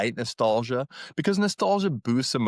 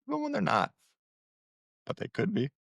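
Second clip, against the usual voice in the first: teasing aside; 9 semitones higher, 5 dB quieter, faster than usual. Real, not acted. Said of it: "teasing aside".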